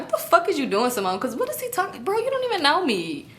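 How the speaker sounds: high-pitched mock disbelief; retold bewildered outburst; incredulous protest. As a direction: question it in a high, baffled voice. Retold disbelief at his love confession.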